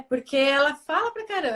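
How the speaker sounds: ordinary talk, nothing marked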